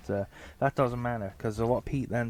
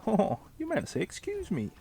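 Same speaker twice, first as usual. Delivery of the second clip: much higher, exclaiming voice; mock prompting; 11 semitones higher than usual. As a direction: pipe it up high as a pointed correction. Child prompting dad to apologise.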